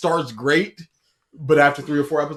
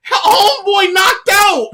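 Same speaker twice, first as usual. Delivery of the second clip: high, loud, slow incredulous yelp; laughing shout; 10 dB louder, slower than usual. Real, not acted. Reacting to the friend being knocked out, laughing loud and high. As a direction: laugh it out in disbelief.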